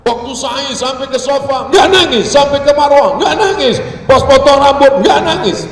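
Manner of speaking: high, loud repeated complaint; mounting exasperation. Real, not acted. Storyteller on pilgrim who never cried, loud exasperation. Exasperated list of failures to cry.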